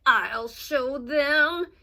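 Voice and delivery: very slow, loud, grudging vow; angry Big Anthony. A narrator's reading. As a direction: grind out a sulky threat. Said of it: "Big Anthony was angry".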